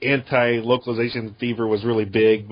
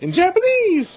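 high squeal; 3 dB louder, slower than usual. Retold or imitated. Mimicking the dub cut to Japanese.

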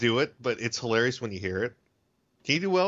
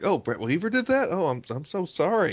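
high-pitched, exaggerated pity; mock dismay; 7 semitones higher than usual. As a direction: feign shocked sympathy for a colleague. Mock pitying reaction to bad work.

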